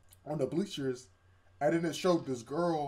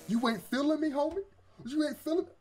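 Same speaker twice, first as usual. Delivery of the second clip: high-pitched, whiny challenge; mock offended; 12 semitones higher than usual. Imitating a rebuffed guy confronting the girl, offended. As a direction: act put out, pitch climbing on the repeat.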